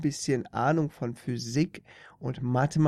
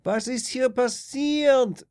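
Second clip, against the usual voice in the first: alarmed astronaut; 12 semitones higher, 7 dB louder, slower than usual. A narrator's reading. Astronaut wakes after accident.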